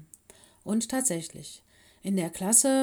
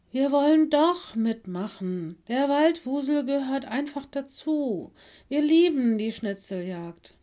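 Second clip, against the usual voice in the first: loud, high pupil's appeal; insistent plea; 7 semitones higher, 6 dB louder than usual. A narrator's reading. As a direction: call out to win the teacher over. Pupils plead with deaf teacher.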